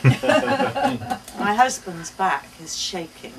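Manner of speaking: laughing through the words; can't hold the laugh in; words broken by laughter. Real, not acted. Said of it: "He's laughing".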